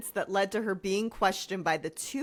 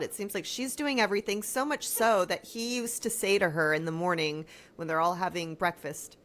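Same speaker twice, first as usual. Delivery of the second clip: usual speaking voice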